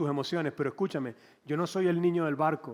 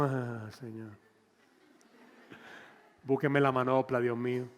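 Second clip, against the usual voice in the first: weary exasperation; 4 semitones lower, 3 dB quieter than usual. Retold or imitated. Pastor's weary reaction.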